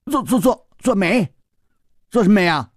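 put-on high boyish stutter into a question; startled stammer. Boy hearing of matchmaking, startled high stammer. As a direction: trip on the first word in surprise.

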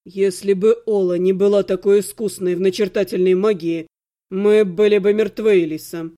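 low, cold reproach; icy. A narrator's reading. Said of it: "Голос Ирги был таким ледяным". (холодный низкий упрёк)

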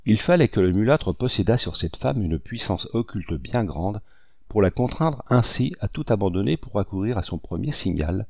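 ordinary delivery

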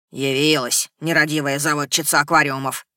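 low, grumbling gurgle; gurgling tentacle alien. Acted. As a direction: gurgle it out like a sulky sea creature. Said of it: "Недовольно пробулькал Эдди".

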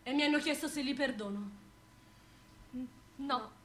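low, soft and grave; hushed refusal. Acted. Victim to the chorus about the offer of pardon, hushed grave refusal. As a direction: say it quietly, like a verdict.

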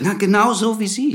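too loud. Acted. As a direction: call it out without thinking. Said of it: "Reden Sie nicht so laut". (zu laut, etwas höher)

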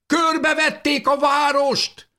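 very high, drawn-out alarmed cry; boy's shout. A narrator's reading. Boy warns the house the town is surrounded, shouting. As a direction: cry out a warning like a frightened boy. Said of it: "kiáltotta a fiú".

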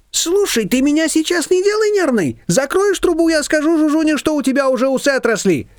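high, mock-indignant neighbour; indignant Shushana. A narrator's reading. Shushana telling off the confectioner, high and indignant. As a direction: huff in a high pitch. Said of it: "возмутилась Шушана".

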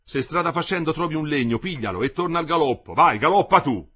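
loud, urgent command building to a shout; engineer's urgent orders. A narrator's reading. Engineer urges Parigi to run, shouting. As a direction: send her off at a gallop. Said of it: "gli aveva detto l'ingegnere".

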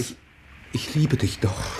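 slow, soft, hesitant; tender confession. Acted. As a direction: admit it gently.

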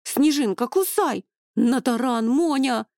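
high, loud battle cheering; excited shouting. A narrator's reading. Vivianna urging the dragons to attack, excited shouting. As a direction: yell them on like a rabid fan. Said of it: "выкрикнула Вив, воздев руки".

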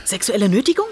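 eager questions shooting up at the end; teasing guesses. Acted. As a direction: fire off playful guesses, each swinging upward.

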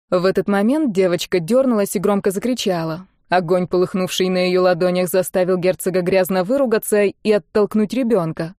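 ordinary delivery, nothing marked

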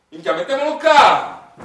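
high, loud eager yell; gleeful shout. Acted. Industrialist shouts to build on the Greek ruins. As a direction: shout it like a greedy developer.

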